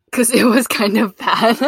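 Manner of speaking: loud, slow, breaking into laughter; real laugh bursting through the words; laughing admission. Real, not acted. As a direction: admit it loudly as the laugh takes over. Admits it was bad, breaking into laughter.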